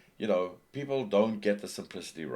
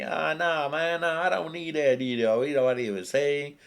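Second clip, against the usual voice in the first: dismissive viewer; 8 semitones higher, 7 dB louder than usual. Pretend. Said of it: "Maybe it's something that you think".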